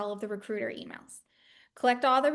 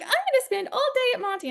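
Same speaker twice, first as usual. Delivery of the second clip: much higher, fast cheery boast; mock student boast; 11 semitones higher, faster than usual. Imitating classmates' boast, high and quick. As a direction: parody the eager all-dayer. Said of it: "people would be like".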